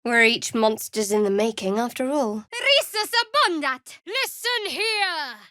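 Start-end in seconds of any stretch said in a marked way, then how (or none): 2.5-4.0 spell incantation. Acted. Casting a spell in combat. 4.1-5.4 commanding call. Acted. Commanding in combat.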